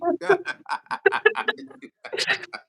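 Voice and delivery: giggling high after the impression; amused squeal of laughter. Real, not acted. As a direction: break into a high-pitched laugh. Laughing at his own impression.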